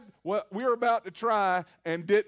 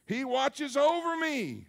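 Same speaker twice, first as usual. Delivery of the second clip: usual speaking voice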